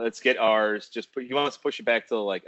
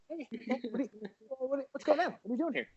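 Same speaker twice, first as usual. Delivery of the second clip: high hushed host voice; 8 dB quieter than usual. Retold or imitated. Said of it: "I'm like".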